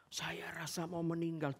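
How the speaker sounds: hushed whisper of dread. Real, not acted. Preacher confessing to the congregation he thought he'd die, whispered. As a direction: breathe it like a secret fear. Recalling terror on the mountain.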